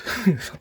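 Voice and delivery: a quick high burst of laughter; short laugh. Real, not acted. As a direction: laugh at the absurd plot. Laughing at dosing plan.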